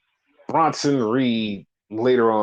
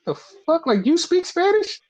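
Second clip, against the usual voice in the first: stunned disbelief. Retold or imitated. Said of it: "Santos was like".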